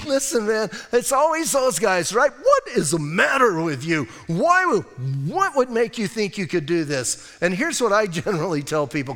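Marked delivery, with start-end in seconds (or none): 2.3-7.4 incredulous challenge. Acted. Naysayers mocking a builder's plan, incredulous challenge. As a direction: scoff as if the plan is absurd.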